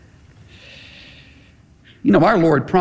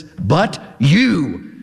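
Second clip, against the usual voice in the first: BUT, then YOU set apart and stressed hard; strong, emphatic. Shown as a demonstration. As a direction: hit YOU with a firm, falling emphasis. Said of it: "very strong, emphatic".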